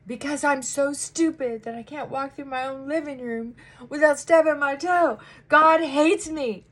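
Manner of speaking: high, loud mock self-pity, slowing at the end; self-pitying whine. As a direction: whine like you're the world's victim. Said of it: "past self answering therapist".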